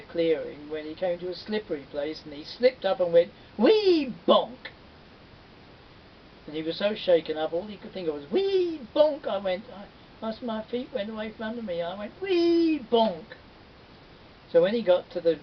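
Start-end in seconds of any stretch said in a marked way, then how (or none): 3.6-4.7 high, loud comic cry; comic tumble shout; slip noise. Acted. Boy slips and falls. 8.3-9.3 high, loud comic cry; comic tumble shout; slip noise. Acted. Boy stuck on the slip noise. 12.2-13.4 high, loud comic cry; comic tumble shout; slip noise. Retold or imitated. Boy retells his slip.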